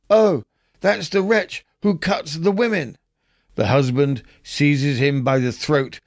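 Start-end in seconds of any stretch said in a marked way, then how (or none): 0.1-3.0 a startled wife's outburst, pitched much higher; burst out. Acted. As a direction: blurt it out on recognising the monster. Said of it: "the wife burst out".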